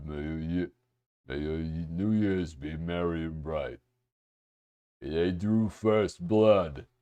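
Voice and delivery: low, soft character voice; put-on movie-character voice. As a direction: reread it low and hushed, in character. Rereads card in character voice.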